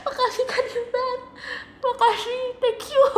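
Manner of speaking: high, laughing makasih; laughing thanks. Real, not acted. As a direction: thank through happy laughter. After the mind-blown moment. (tertawa haru sambil berterima kasih)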